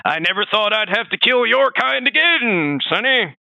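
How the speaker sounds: put-on high, slow cranky old-man voice; creaky old geezer scolding, held slow; grumpy old man. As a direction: shake your fist at the kids on your lawn. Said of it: "He's like".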